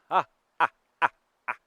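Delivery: a scornful fake laugh aimed at the ump; sarcastic laugh. Head coach mocking the umpire with a loud sarcastic laugh. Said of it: "just gives this sarcastic laugh".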